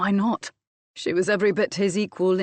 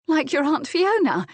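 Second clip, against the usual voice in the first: high, loud scathing retort; 9 semitones higher, 5 dB louder than usual. A narrator's reading. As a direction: fire back with scorn. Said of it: "Jasmine replied scathingly".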